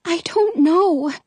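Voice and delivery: high, slow, worried reply; a touch of fear, voiced; fearful whisper. A narrator's reading. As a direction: answer helplessly in a small high voice. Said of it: "she whispers, her eyes wide with fear".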